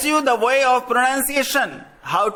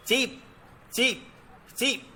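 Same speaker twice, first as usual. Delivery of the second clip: softer, tiny peeping; little cheeps of the tiniest chick; 8 dB quieter than usual. Shown as a demonstration. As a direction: peep softly like a tiny chick. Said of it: "Now the tiniest chicken".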